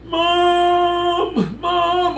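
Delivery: high, long-held mock call of "Mom"; sing-song high holler. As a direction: stretch it out like a kid hollering for Mom. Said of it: "she's trying to say".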